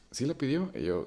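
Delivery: usual speaking voice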